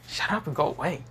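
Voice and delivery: high, embarrassed brush-off; mildly acted flustered daughter; flustered retort. A narrator's reading. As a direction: pitch up, shooing someone off. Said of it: "Rainer's face is red and say".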